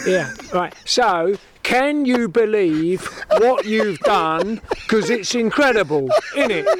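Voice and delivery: slow London interviewer; drawn-out London accent question. Acted. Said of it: "I'll be Seb".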